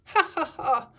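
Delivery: loud, spaced-out ha-ha-ha; hammed-up belly chuckle; acted hearty chuckle. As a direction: chuckle like a jolly storybook father. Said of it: "chuckles Daddy Pig".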